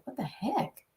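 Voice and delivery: breathy shocked whisper. Retold or imitated. Catching herself mid-thought, whispering in shock. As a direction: hiss it in disbelief. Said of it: "when I catch myself like".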